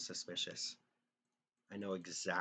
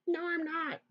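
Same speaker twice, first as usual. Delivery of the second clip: mocking ex-girlfriend; 6 dB louder than usual. Ex-girlfriend answering back in argument.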